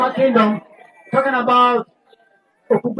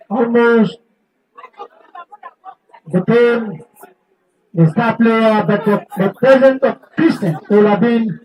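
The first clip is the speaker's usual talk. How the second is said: radio sports presenter. Real, not acted. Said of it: "that radio voice".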